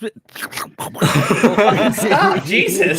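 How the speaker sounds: high, laughing protest. Real, not acted. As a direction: crack up while telling them to stop.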